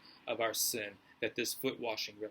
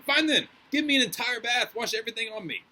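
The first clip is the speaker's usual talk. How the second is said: much higher, louder eager plea; emphatic turnaround; 10 semitones higher, 7 dB louder than usual. Acted. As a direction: Peter overeager, blurting his change of heart. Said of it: "emphatic in his turnaround".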